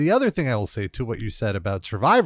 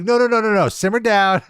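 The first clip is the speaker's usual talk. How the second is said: higher, louder hold-it-down plea; calming the crowd; 7 semitones higher, 4 dB louder than usual. Retold or imitated. Wrestler telling the amped crowd to calm down, higher and louder. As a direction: wave the crowd back down.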